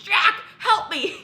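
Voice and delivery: high, loud, slow panicked cry, laughing through it; laughing imitation of a panicked dad; dad yelling for help. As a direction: shout for help as the tap explodes. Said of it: "And my dad goes".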